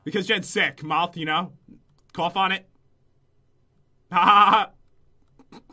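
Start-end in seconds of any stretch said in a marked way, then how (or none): 4.1-5.6 loud fake laugh; stilted, loud "ha ha"; forced ha ha. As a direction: fake a laugh to rescue the joke. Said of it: "tried to save it with a ha ha".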